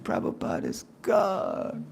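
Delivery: low, slow, grave declaration; solemn low pronouncement; low intoned insistence. Retold or imitated. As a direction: drop it down and slow it like a creed. Devotee insisting, repeated creed.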